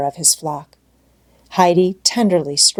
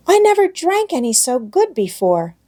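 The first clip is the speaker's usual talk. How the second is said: put-on high, bright child voice; little girl Heidi; 9 semitones higher than usual. A narrator's reading. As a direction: play an eager little girl. Heidi's voice in story.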